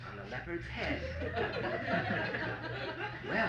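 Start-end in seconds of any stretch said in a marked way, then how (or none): 1.1-3.2 real high-pitched chuckle; laughing. Real, not acted. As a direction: laugh at one's own punchline. Narrator laughs at the joke.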